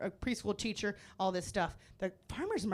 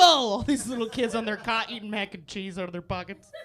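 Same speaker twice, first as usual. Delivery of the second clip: normal talking voice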